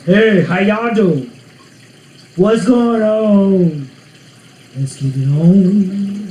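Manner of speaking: slow, drawn-out boozy drawl; cognac voice. Retold or imitated. Teasing her sister over there, imitated cognac voice. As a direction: imitate a sister's cognac voice, dragging each word. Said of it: "sounding like she got cognac voice".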